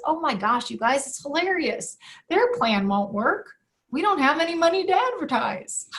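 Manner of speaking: amused disbelief, breaking into a laugh at the end. Retold or imitated. Dad laughing at his panicked workers' news, amused. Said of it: "I just started laughing".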